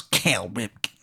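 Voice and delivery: low-volume, muffled mutter to himself; a soft tic spoken into the armpit. Acted. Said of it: "He says it into his armpit".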